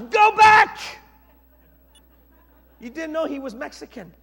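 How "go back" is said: very loud, high bellow; shouted command. Acted. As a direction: shout the order at full force. Elijah's climactic repeated order.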